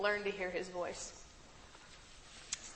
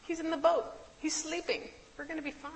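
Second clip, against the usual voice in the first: obvious reassurance; 8 semitones higher than usual. Retold or imitated. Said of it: "they didn't make the connection".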